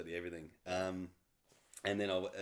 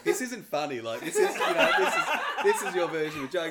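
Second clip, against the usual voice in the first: loud, high mock outrage through laughter; retold anger; 9 semitones higher, 13 dB louder than usual. Husband retelling his reaction to wife's surprise trip, mock angry. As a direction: protest indignantly, half laughing as you retell it. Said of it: "then I got really angry".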